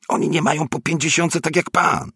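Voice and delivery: very high-pitched, agitated retort; excitable neighbour retorting. A narrator's reading. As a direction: shoot it back in a high, worked-up voice. (wysoki, podekscytowany głos sąsiada)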